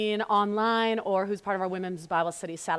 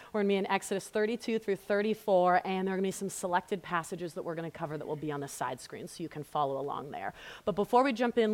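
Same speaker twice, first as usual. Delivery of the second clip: ordinary delivery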